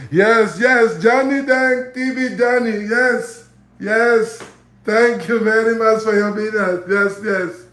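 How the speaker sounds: high, loud beaming salesman. Retold or imitated. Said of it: "Johnny Dang probably talk like this".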